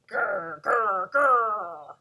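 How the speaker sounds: playful loud dinosaur growl; scary dinosaur growl. Pretend. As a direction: growl like a pretend dinosaur for kids. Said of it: "or a scary dinosaur".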